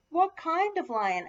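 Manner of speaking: high, loud curious question; eager question. A narrator's reading. Sarah asks Matthew about his lion, eager high question. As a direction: ask it high and keenly. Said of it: "asks Sarah".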